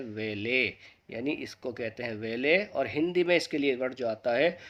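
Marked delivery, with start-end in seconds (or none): 0.0-2.6 "वेले" with the final t silent; correct pronunciation. Shown as a demonstration. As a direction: say "वेले", not "वैलेट". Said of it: "सही pronunciation". (सही उच्चारण "वेले", टी नहीं बोलते)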